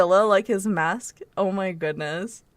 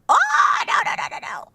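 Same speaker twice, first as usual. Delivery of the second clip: a high, loud shocked "oh" falling to breathless noes; dismayed cry; 3 dB louder than usual. Real, not acted. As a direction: cry out as the card slips away. Loses the seven card.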